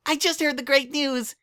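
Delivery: bouncy indignant squeal; high excited filly voice; Pinkie, indignant. A narrator's reading.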